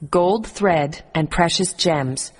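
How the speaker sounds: ordinary delivery, nothing marked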